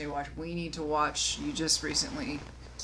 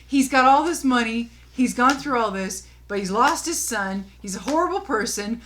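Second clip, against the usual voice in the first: loud, high-pitched exasperated rant; worked up over a pointless ending; 7 semitones higher, 9 dB louder than usual. Real, not acted. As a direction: rant it out at raised pitch and volume. Exasperated rant about the film's ending.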